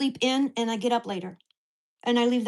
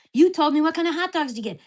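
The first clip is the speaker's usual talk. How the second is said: heated retold argument; 5 semitones higher, 5 dB louder than usual. Retold quarrel with neighbor.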